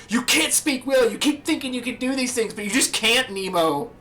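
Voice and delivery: high, loud frantic fatherly yell; panicked Marlon. Retold or imitated. Marlon yelling at Dory inside the whale, high and frantic. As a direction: shriek it like a panicked dad. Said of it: "and he's like".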